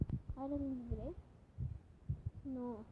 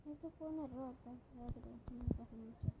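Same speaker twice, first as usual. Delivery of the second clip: soft murmur; 16 dB quieter, faster than usual. Acted. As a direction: mutter "error" under the breath, softly. Couple quarrel, first take.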